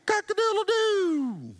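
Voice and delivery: high, slow rooster crow imitation; slow hammed-up rooster call. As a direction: stretch out a high cock-a-doodle-doo. Rooster crows second time.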